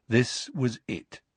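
usual speaking voice

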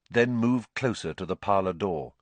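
usual speaking voice

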